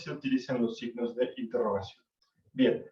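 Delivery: usual speaking voice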